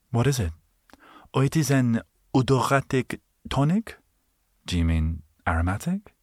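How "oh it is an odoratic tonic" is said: higher, prim shop voice; snooty shopkeeper. Pretend. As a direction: sell it with haughty pride.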